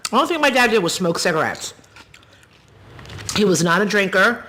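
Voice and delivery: ordinary talk, nothing marked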